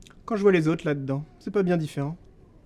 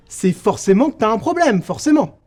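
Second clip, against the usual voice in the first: plus fort et plus aigu, indigné; martèle « forcément » avec indignation; emporté; 6 semitones higher, 8 dB louder than usual. Acted. S'emporte contre le regard des autres. (éclat d'indignation comique)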